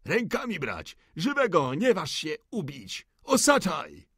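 high-pitched officer's shout; shouted orders. A narrator's reading. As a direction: cry out urgent commands to your men. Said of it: "krzycząc swoje".

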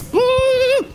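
high, indignant "mm"; scolding hum. Acted. Princess shaking her finger at the swineherd, high indignant hum. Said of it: "shaking her finger at him like this".

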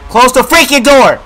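high, loud shouted order; panicked shout. Real, not acted. Scared player ordering the door closed, panicked shout. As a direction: yell the repeated order with force.

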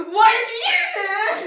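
high, loud mock scream, drawn out; imitated scream. Retelling when Jay screamed at them, mock screaming. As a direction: yell it slowly, imitating a friend's outburst. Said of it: "When Jay was screaming at me".

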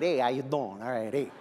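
low, cool New York cat; New York attitude. Acted. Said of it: "have a New York attitude".

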